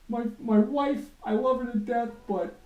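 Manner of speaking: high, loud mock ranting yell. Imitating the comedian ranting about street cleaners, mock yell. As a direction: play an angry comic ranting. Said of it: "rant about".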